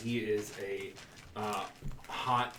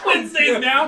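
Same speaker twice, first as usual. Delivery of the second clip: high, loud laugh through the words; 12 semitones higher, 13 dB louder than usual. Real, not acted. Laughing at new intros.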